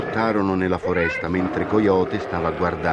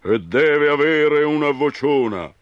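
loud thundering call, pitched higher; booming bear; 10 semitones higher, 6 dB louder than usual. Acted. Grizzly thunders for a big voice, loud. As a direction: boom it out. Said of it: "tuonò Orso Grigio".

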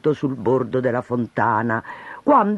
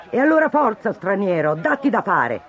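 a hint of a bossy mayor; mayor ordering; 6 semitones higher than usual. Mayor orders the stranger, mildly bossy. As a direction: snap out an order, higher start. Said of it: "ordinò il sindaco".

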